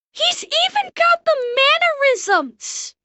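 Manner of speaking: high-pitched, astonished delight; real disbelieving glee; amazed delight. Real, not acted. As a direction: exclaim it high, stretching the words. Amazed by the impression's mannerisms.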